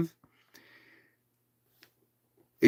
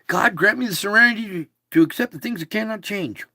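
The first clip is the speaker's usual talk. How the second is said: higher, fast prayer; serenity prayer; 7 semitones higher, 3 dB louder, faster than usual. Shown as a demonstration. Speaker reciting a favourite prayer, fast and higher. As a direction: rattle off the prayer quickly. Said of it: "One of my favorites".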